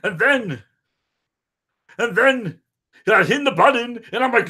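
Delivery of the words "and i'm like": ordinary talk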